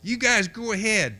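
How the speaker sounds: high call from far down the beach; retold distant yell, pitched high and carefree; yelling back. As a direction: shout it back across the sand. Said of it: "And he yelled back".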